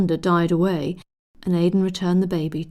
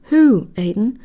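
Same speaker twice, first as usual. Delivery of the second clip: perplexed; 7 semitones higher, 4 dB louder than usual. A narrator's reading.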